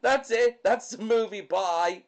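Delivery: laughing loudly through the words; giggling the wrap-up, high and loud; cracking up while saying bye. Real, not acted.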